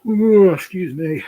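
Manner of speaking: words stretched through a big yawn. Real, not acted. Apologizing to the chat while yawning mid-sentence. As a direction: yawn it out, slow and high. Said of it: "I'm sorry for yawning".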